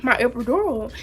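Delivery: normal talking voice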